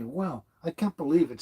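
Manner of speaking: usual speaking voice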